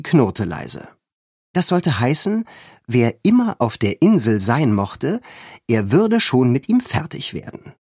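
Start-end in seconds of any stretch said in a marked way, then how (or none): none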